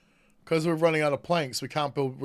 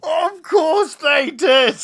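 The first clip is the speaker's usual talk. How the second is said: slow, high exasperated yell; exasperated shout; 12 semitones higher, 10 dB louder, much slower than usual. Real, not acted. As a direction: cry it out in disbelief. Exasperated outburst at game.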